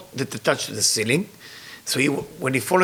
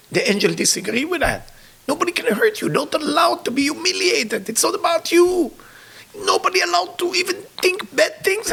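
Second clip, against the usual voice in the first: high, choked-up imploring; impassioned tearful plea; 12 semitones higher than usual. Retold or imitated. Rabbi imploring the humble Maharsha to lead, tearful pleading. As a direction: plead in a high voice full of emotion. Retold plea, teller overcome by tears.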